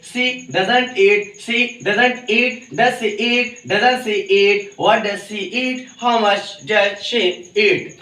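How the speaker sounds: slow, deliberate English drill, each word spaced out; slow drill pronunciation. Shown as a demonstration. Teacher drilling students in spoken English, slow and deliberate. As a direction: say every phrase slowly for the class to repeat. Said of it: "और बोलने का तरीका भी सीखेंगे".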